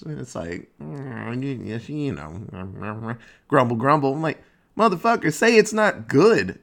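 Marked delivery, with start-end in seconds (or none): 0.8-4.1 slow, low-volume mumbled hedging; mock a politician mumbling round an answer; evasive grumbling. Mimics politicians evading the question. 4.8-6.6 higher, emphatic retort; exasperated demand. Retold or imitated. Exasperated retort to evasive politicians.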